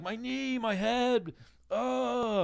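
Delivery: high, hammed-up whimper of pain; retelling the thrown-out kid, mock whining. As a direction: play a kid hurt on the curb.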